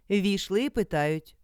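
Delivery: ordinary delivery, nothing marked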